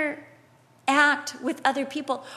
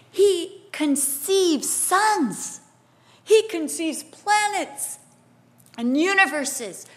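slow, high, emphatic wonder; awed proclamation; 3 semitones higher, slower than usual. Real, not acted. Preacher marvelling at God's creation, slow awed proclamation. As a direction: stretch each word in raised amazement.

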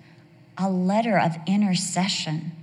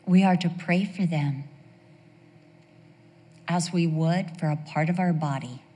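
ordinary delivery

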